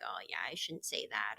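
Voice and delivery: put-on child admitting a slip; sheepish kid. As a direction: mumble it like a child caught out.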